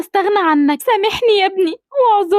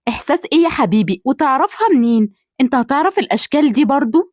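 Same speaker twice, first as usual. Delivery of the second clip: much lower, gentle motherly voice; lower-pitched mother greeting her son; older mother voice; 8 semitones lower than usual. Acted. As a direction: speak as the doting mother.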